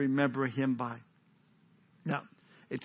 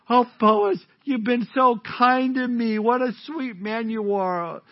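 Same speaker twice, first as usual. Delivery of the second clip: gooey love talk; 6 semitones higher, 5 dB louder, slower than usual. Acted. Preacher voicing Ruth's gooey love talk.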